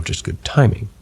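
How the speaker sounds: ordinary delivery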